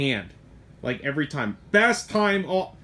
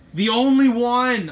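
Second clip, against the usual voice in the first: slow, higher, louder stress on "only"; emphatic stress; 7 semitones higher, 6 dB louder, slower than usual. Real, not acted. Shop owner insisting to viewers, stressing "only". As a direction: lean hard on "only" to drive it home. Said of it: "harp on it enough".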